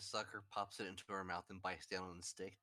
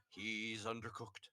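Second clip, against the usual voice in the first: deadpan tiger; slower than usual. Retold or imitated. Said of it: "the saber-toothed tiger merely said".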